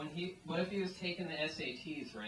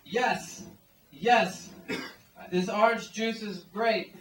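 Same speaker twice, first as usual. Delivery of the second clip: louder and higher, a hammed-up cry of joy; mock rejoicing; 6 semitones higher, 10 dB louder, slower than usual. Customer raising orange juice to the sky, hammed-up rejoicing. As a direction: cheer it like you just found heaven in a glass. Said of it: "you'll rejoice and you'll be like".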